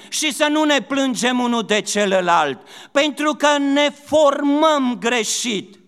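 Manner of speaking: very high, loud warning, slowing at the end; impassioned preacher rebuking the church; preaching at full force. Acted. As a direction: thunder the warning from the pulpit. Preaching burst, raised voice.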